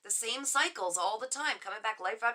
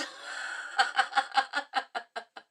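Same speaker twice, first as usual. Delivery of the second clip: airy unvoiced laughter; breathy laugh; 3 semitones higher than usual. Real, not acted. Laughing at a comment.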